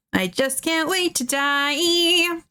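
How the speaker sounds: I just can't wait to die, drawn out high; high slow drawn out. Shown as a demonstration. Streamer reading chat, high and slow. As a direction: stretch the words up high and slow. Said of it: "repeated in higher tone".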